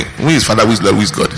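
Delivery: ordinary talk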